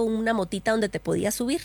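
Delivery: usual speaking voice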